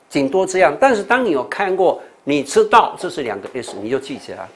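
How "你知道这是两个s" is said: normal talking voice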